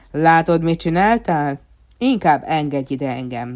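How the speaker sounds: loud, scolding mayor; reproachful. A narrator's reading. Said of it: "mondta a polgármester megrovón".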